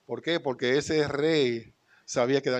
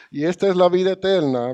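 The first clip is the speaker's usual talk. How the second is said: louder, lifted reading of the verse; scripture proclaimed; 5 semitones higher, 6 dB louder than usual. Acted. Lectura bíblica proclamada. (lectura bíblica proclamada)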